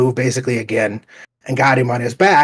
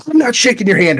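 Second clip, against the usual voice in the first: snub; 10 semitones higher, 4 dB louder than usual. Retold or imitated. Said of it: "And he's like".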